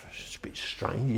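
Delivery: slow, breathy whisper to himself; hushed aside to himself; whispered thought. Retold or imitated. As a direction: mutter it slowly under your breath like a private suspicion. Private thought about a stranger.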